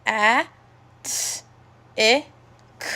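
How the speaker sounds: each sound of "attic" said alone, a pause between. Shown as a demonstration. Teacher sounding out "attic" sound by sound for the class. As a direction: split "attic" into its separate sounds. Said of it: "sound out the word attic".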